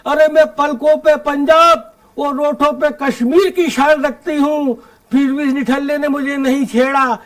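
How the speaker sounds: lilting, declaimed humorous verse; comic poem recitation. Acted. Poet telling the Holi mishap to the audience, comic verse recitation. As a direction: recite in measured lines for a live crowd.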